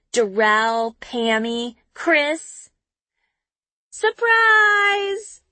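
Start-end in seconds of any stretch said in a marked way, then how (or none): 3.9-5.4 high, loud group yell of surprise; surprise party shout. A narrator's reading. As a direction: shout it out as the lights come on. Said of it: "everyone screams".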